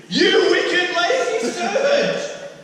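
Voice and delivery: high, loud, slow hammed-up rebuke through laughter; laughing mock rage. Preacher playing the master's rebuke, laughing and loud. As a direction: ham up the master, cracking up. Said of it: "he was scared".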